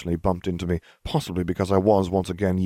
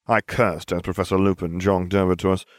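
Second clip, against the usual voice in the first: usual speaking voice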